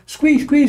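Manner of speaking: high mousy squeak; mock squeak. Preacher mocking a scared mouse, squeaky.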